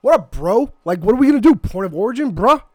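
high, loud pumped-up meathead; Jersey Shore bro. Acted. [name] as the Jersey bro asking for a suggestion, high and loud. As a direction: go full juiced-up bro. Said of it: "Nick Kroll caricature of Jersey Shore".

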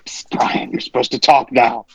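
hushed, breathy prompt to a guest; stage whisper. Real, not acted. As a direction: whisper it so the mic barely catches it. Nudging the silent guest on air.

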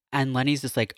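usual speaking voice

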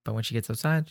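normal talking voice

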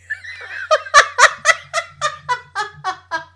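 big high laugh; loud burst of laughter. Real, not acted. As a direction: crack up loudly.

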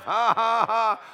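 high, loud forced ha-ha-ha; defiant laughing. Shown as a demonstration. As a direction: punctuate the verse with a big laugh. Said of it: "Let's try that again".

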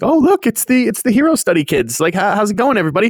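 high, breezy excited greeting; excited Hawks. Retold or imitated. Hawks spots the hero study kids, playfully excited. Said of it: "He's excited 'cause he's like".

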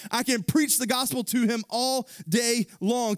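high, loud preaching voice; full-force preaching. Acted. Preacher driving the point home, full force. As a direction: preach it hard to the congregation.

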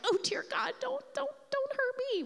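high, pleading cower; frightened Moses pleading from behind a rock; cowering plea. Pretend. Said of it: "I'm, like, cowering behind a rock".

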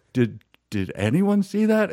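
high, disbelieving question; a lightly voiced astonished fan; stunned question. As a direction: ask if anyone else saw it. Asking friends after the shock.

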